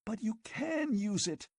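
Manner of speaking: high, eager plea; girl pleading with the hesitant magician; passionate argument. A narrator's reading. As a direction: argue it with passion. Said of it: "she argued passionately".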